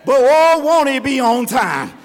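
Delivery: loud preaching cry, peaking on oh; rising old saying. Retold or imitated. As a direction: belt out the old folks' saying. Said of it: "The old folks used to say".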